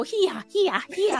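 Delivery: high-pitched demonstrated critter call; animal speak. Acted. Neb teaching Feruza the affirmative, animal speak. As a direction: show off the creature's call, up high. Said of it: "trying to speak as the animal".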